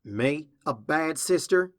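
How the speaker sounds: slow, loud, affronted disbelief; indignant snort. A narrator's reading. Applejack answers her sister's accusation, indignant disbelief. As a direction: stretch it out, offended and incredulous. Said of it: "Applejack snorts".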